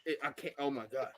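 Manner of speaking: usual speaking voice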